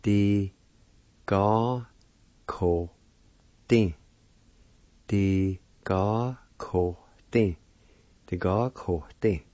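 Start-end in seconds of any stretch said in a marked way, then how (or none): 0.0-3.9 "Di ga ko tin" in isolated syllables, long pauses. Shown as a demonstration. Teacher pronouncing the word plant, "Di ga ko tin" in separate syllables. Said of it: "is pronounced like this". 5.1-7.6 "Di ga ko tin" slowly, each syllable drawn out. Shown as a demonstration. Teacher pronouncing the word plant, "Di ga ko tin" slowly in syllables. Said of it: "is pronounced like this".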